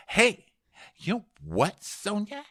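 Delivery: high, halting put-on questioning; Ramona impression. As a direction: ask it with jumps in pitch. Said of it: "Ramona? She goes".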